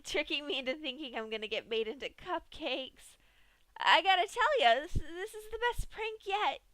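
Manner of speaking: high, loud, cracking with panicked laughter; near-hysterical laughter. A narrator's reading. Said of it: "said in a voice bordering on hysteria".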